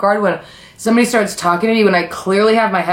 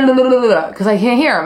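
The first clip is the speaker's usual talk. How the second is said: normal talking voice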